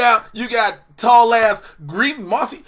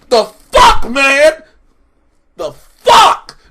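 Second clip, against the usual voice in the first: much higher, loud incredulous yell; outraged disbelief; 5 semitones higher, 9 dB louder, much slower than usual. Real, not acted. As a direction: yell it at the screen. Outraged at ending.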